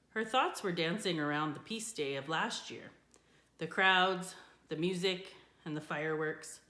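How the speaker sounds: ordinary delivery, nothing marked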